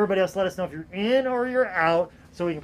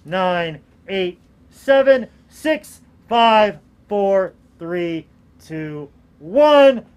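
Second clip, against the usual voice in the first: louder, building countdown; excited countdown; 9 dB louder, much slower than usual. Real, not acted. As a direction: count down the last seconds with rising excitement. Auction countdown.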